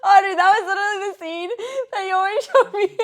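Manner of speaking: high, loud and laughing; cracking up at the memory; laughing hard. Real, not acted. Recalling funny video, cracking up.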